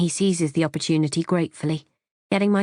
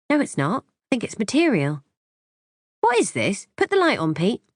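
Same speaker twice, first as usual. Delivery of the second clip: mock curiosity; 4 semitones higher than usual. A narrator's reading. Feigned discovery to husband.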